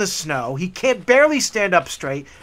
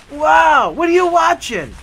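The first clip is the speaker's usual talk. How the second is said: higher and louder, wide-eyed; amazed shout; 8 semitones higher, 5 dB louder than usual. Retold or imitated. Tall guy spots the TV, loudly thrilled. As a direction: blurt it out in delight.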